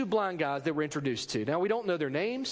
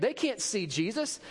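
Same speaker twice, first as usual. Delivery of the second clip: ordinary talk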